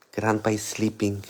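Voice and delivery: soft, hushed voice by a sleeper. Real, not acted. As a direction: keep it low for Grandpa. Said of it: "I'm not disturbing".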